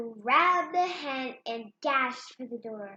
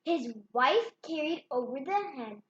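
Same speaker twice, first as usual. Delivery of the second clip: usual speaking voice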